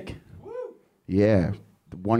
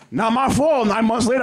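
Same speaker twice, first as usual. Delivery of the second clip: mock protest; 10 semitones higher than usual. Punchline to dad, high pitched.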